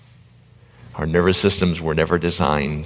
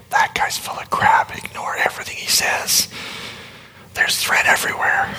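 whispered, suspicious inner voice; amygdala's voice. Pretend. The amygdala warning the listener off, whispered. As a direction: hiss doubt into the listener's ear. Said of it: "if your amygdala is not saying to you".

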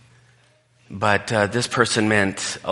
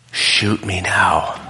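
mock despair; much slower than usual. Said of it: "I'm just like".

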